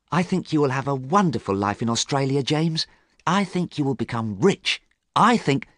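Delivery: high, eager, quick-spoken sister; happy. A narrator's reading. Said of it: "Sybil was happy".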